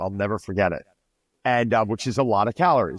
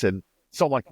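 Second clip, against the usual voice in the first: ordinary talk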